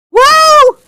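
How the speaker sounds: sudden loud, high yelp of surprise; startled whoa. Real, not acted. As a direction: jump at the sight of a spider. Spider suddenly appears mid-lesson.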